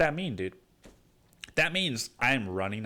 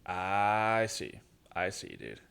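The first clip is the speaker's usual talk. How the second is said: deadpan; 4 semitones lower, 5 dB quieter, slower than usual. Acted. Deadpan low reply after outburst.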